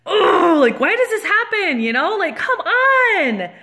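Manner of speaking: loud groan into a high, whiny plea; mad and exasperated. Retold or imitated. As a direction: vent in high-pitched, exasperated disbelief. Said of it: "hotter and madder all the way".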